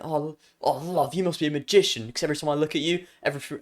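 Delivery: soft attempt at Jay's accent; imitating Jay's accent. Streamer trying the magician line as Jay, mimicked accent. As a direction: quietly put on Jay's accent. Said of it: "do it in his accent".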